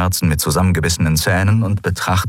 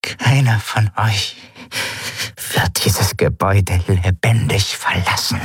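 effortful menacing whisper. A narrator's reading. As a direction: force each word out with great effort. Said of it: "sprach die Skria weiter". (langsames, mühsames, drohendes Wispern)

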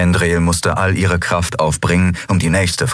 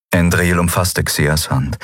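ordinary delivery

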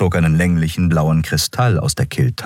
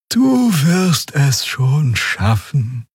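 very slow, higher, lazy drawl; sluggish; 8 semitones higher, much slower than usual. A narrator's reading. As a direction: say it half asleep and unhurried. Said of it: "sagte Xia träge".

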